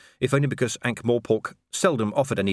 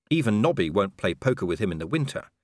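plain reading voice

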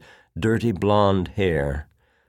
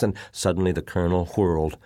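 ordinary delivery, nothing marked

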